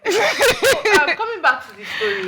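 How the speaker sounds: high laughing words; laughing leftover; laughing while talking. Real, not acted. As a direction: still giggling, trying to get back on track. Still laughing after story.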